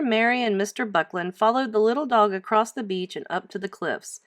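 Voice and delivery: ordinary delivery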